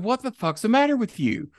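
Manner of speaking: outraged confrontation. Retold or imitated. He confronts Brock after being pushed, high and outraged. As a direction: fire back at the man who shoved you.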